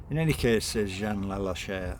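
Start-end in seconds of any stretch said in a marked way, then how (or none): 0.1-0.6 mother's voice. Acted.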